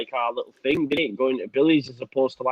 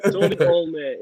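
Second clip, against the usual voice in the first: broad Yorkshire accent; 3 semitones higher, 4 dB louder than usual. Shown as a demonstration. Asked by his co-host, says his favourite food in broad Yorkshire accent. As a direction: drop the article and lean into broad Yorkshire. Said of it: "if you said that in a Yorkshire accent".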